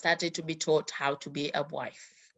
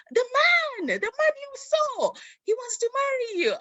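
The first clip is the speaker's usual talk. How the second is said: much higher, thrilled shriek of joy; excited aunt; 3 dB louder than usual. Retold or imitated. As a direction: squeal the big news. Aunt announces the proposal.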